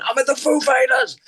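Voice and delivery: lightly voiced excited friend; friend on the phone.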